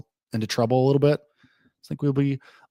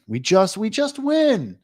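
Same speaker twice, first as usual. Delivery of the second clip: giddy drawn-out win; 9 semitones higher, slower than usual. Real, not acted. Betting stream, repeats win line.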